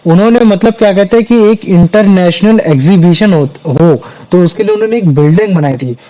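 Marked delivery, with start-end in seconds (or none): none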